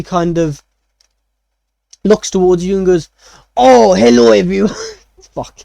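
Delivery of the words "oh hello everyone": high, loud, hammed-up French greeting; French chef accent. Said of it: "Chef French, huh?".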